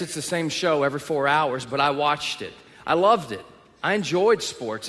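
normal talking voice

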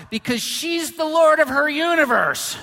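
loud, high proclamation; booming, mock-grand declaration; emphatic shout. Real, not acted. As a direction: thunder out the punchline. Preacher exclaims the punchline.

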